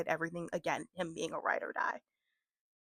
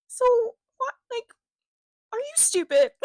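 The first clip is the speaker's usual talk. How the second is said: high, louder mocking question; sarcastic scorn; 9 dB louder than usual. Heroine scorning the people, high sarcastic disbelief. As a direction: scoff at them as if they're idiots. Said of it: "her sarcasm to all these people".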